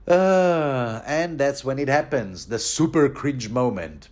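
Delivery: usual speaking voice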